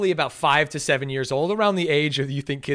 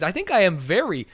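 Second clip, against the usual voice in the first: ordinary talk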